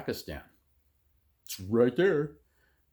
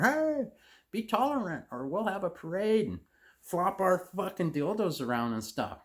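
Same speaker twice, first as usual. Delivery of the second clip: mocking camp voice; 9 semitones higher than usual. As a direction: sneer through a high put-on voice. Mock voice for homosexuals to Taliban.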